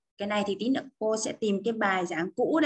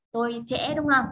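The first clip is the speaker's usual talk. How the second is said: normal talking voice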